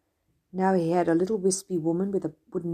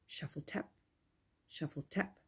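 whispered footstep sounds; 13 dB quieter than usual. Acted. Imitating the wooden-leg footsteps.

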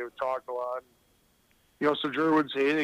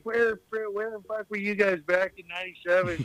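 mock heckle; 4 semitones higher than usual. Mocking bandwagon fans in crowd.

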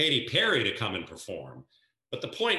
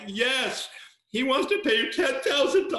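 much higher and louder, breaking with emotion; overexcited retelling; 5 dB louder than usual. Retold or imitated. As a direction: blurt the big news almost in tears. Retold tearful phone call, excited.

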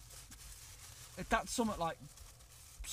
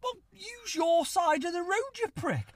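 high, slow, louder insult; retold angry retort; 13 semitones higher, 5 dB louder than usual. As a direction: tell him off in anger. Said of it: "And I was like".

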